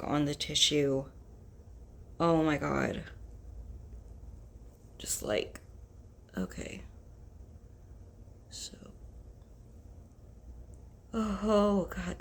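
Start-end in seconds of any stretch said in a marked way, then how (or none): 5.0-8.7 whispering. Real, not acted. Quietly tickling nose.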